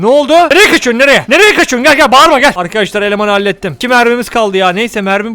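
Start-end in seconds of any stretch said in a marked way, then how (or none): 0.0-2.5 loud, higher calls after someone running; calling after him. Real, not acted. Chasing his fleeing companion, calling loudly. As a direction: call him back, urgent.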